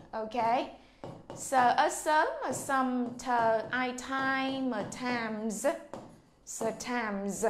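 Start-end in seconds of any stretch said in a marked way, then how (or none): 1.4-5.7 slow sound-by-sound build of "sometimes"; spelling out sounds. Shown as a demonstration. Teacher slowly spells out the sounds of "sometimes". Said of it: "Và từ sometimes".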